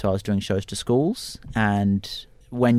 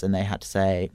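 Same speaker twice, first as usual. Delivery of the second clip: ordinary delivery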